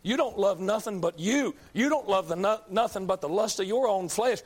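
normal talking voice

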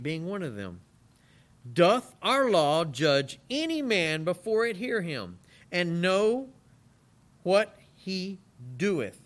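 slow, weighty Bible reading; scripture reading. Acted. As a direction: read the verse slowly and solemnly. Reads scripture aloud.

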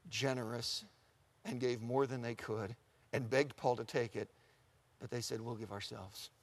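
low, soft preaching with long pauses; solemn sermon close. Real, not acted. Preacher appeals to young people to give themselves, hushed and solemn. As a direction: bring it down to a hushed, weighty appeal.

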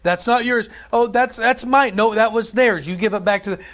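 mock-scold a child who grabbed a toy; hammed-up parent telling off a kid; correcting a child. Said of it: "you might correct a child and go".

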